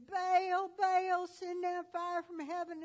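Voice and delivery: high, loud pleading cry to Baal; mock frantic calling. Prophets begging Baal for fire, high frantic cry. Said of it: "they hollered, and they screamed".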